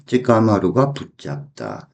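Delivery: ordinary talk, nothing marked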